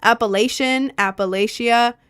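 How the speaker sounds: "Appalachia" with a long A, "lay-shee-uh"; formal long-A "Appalachia"; technical pronunciation. Shown as a demonstration. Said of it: "technically called".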